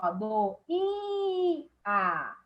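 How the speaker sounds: do, i, a drawn out slowly syllable by syllable; syllable split. Shown as a demonstration. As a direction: stretch out each syllable of doía. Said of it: "a sílaba tônica é a penúltima". (do, i, a bem devagar, sílaba por sílaba)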